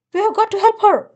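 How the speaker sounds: high, fast urging; urgent captain. Acted. Captain urging everyone to rescue Mama, high and fast. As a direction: press everyone to help.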